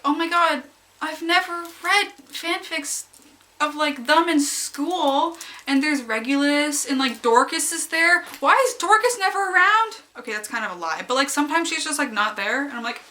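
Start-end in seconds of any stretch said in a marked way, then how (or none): 0.0-10.0 gushing excitement. Retold or imitated. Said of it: "I was like".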